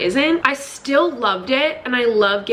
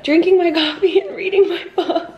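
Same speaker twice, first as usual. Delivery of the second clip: laughing at herself; 8 semitones higher than usual. Real, not acted. Laughs at her own slip.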